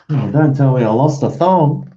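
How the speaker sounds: high, loud groan of dismay; can't believe the thong is gone. Real, not acted. Thinks he lost a thong.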